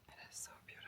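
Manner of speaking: hushed awed whisper. Real, not acted. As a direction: breathe it out in awe. Admiring the view.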